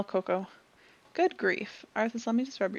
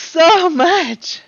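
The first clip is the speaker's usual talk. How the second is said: loud, high stretching yawn; big yawn; 5 semitones higher, 14 dB louder than usual. Real, not acted. Streamer yawning mid-stream, loud yawn. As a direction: let out a huge real yawn. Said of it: "why am I yawning".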